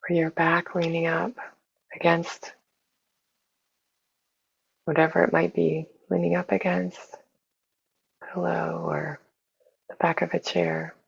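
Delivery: soft, unhurried meditation voice with long pauses; hushed, even guidance for settling into the body; guided meditation. Real, not acted. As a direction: gently guide listeners inward, leaving space between phrases.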